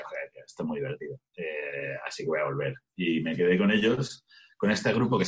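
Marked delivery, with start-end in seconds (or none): 0.0-2.7 soft fast inner thought; quiet. Retold or imitated. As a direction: say it softly to yourself. Inner thought, retold softly. (en voz baja)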